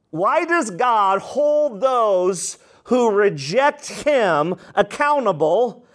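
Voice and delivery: normal talking voice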